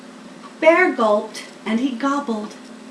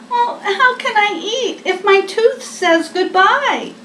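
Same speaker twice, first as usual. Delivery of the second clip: a touch of tearful worry, higher voice; higher, mildly tearful bear voice; worried Bear; 8 semitones higher, 4 dB louder than usual. Acted. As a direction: Bear close to tears over his tooth. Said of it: "Bear frowned and he worried. Tears welled in his eyes".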